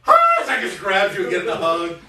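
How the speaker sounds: high, loud joyful shout; happy hug, shouted out loud; delighted cry. Acted. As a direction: squeal and grab them. Fiala hugs her friend.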